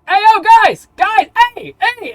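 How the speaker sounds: high yelling call for attention; shouting. Acted. Dragonborn yelling across the town square to the group. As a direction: bellow to get the crowd's attention.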